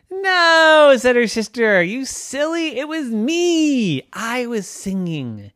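high, teasing reveal; teasing sister. Acted. Sister telling Trisha it was her singing, teasing. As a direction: gently tease her little sister.

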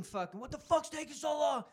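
high-pitched yelling without full volume; mock screaming. Drunk bro waiting in line, mock screaming. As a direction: imitate an impatient drunk's scream.

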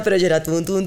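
normal talking voice

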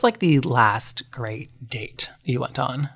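slow, breathy whispered question; teasing whisper. Real, not acted. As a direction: lean in and whisper it slowly.

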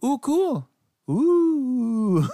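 high, squealing mock delight; mock excited ooh. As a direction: ooh it up like a thrilled fan. Mock excited reaction, cry heard.

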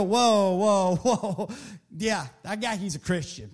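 low, scoffing "whoa, that guy"; mocking onlookers. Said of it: "they're gonna go".

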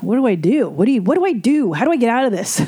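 imitating a flustered plea, pitch swooping up and down; pleading, wavering retold questions; panicked patient. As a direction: act out a mildly hammed-up panic at the shrink. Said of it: "I asked my shrink".

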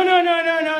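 ordinary talk

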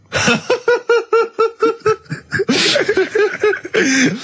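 long, high, helpless burst of laughter; big laugh. Real, not acted.

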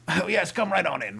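high, loud mock welcome; hammed-up delighted greeting; eager party host. Acted host welcoming the creepy guest.

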